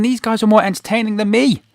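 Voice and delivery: self-mocking inner voice. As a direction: play it as a rueful joke at his own expense. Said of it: "I'm thinking".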